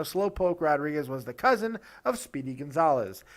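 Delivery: ordinary delivery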